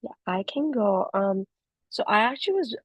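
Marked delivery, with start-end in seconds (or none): none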